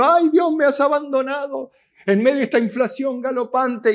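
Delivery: whining complainer. Retold or imitated. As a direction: ham up a wailing believer abandoned by God. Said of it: "Nos quejamos y".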